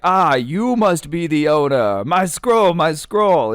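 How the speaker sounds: high, grand theatrical royalty; slow regal exclamation, then urgent high calls; hammed-up king. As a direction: call for it like an overacting monarch. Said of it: "hamming it up when it comes to the acting".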